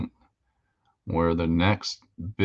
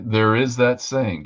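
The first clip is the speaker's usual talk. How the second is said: ordinary delivery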